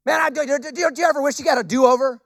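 ordinary talk, nothing marked

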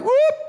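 comic, very high whoop; high-pitched whoop. Acted. Someone caught in the spotlight makes a getaway, comic high whoop. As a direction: whoop sharply upward, as if darting away.

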